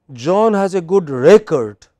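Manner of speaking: "record" as REcord, first syllable strong; teacher's example with REcord as a noun; noun stress on RECord. Shown as a demonstration. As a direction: model the sentence, punching RE in "record". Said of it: "it is record".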